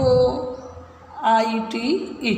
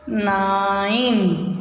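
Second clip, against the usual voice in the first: slow model word; much slower than usual. Shown as a demonstration. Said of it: "teacher models word".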